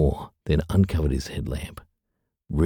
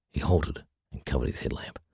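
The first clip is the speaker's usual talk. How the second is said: ordinary delivery, nothing marked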